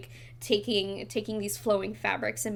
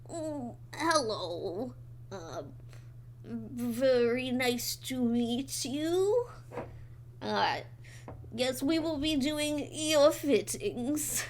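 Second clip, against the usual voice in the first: slow, soft lilting voice; lilting fish lady; 3 semitones higher, 3 dB quieter, slower than usual. Acted. Fish tailor greets the party in a slow, soft, lilting voice. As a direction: draw each word out gently like a dainty fish lady. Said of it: "one says ... more lilting, and gentle".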